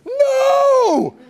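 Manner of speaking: sudden high yell of No; a panicked partner yelling stop, yelling in alarm. Retold or imitated. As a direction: shout a warning to stop him. Partner yells as he runs.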